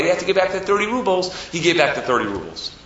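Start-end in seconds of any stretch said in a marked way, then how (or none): none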